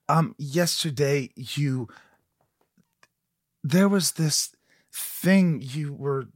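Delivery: slow, halting, lightly voiced detective; Vincent D'Onofrio impression. Host slowly imitates Vincent D'Onofrio's TV detective role. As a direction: draw it out with long hesitant pauses. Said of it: "I was doing my impression of him".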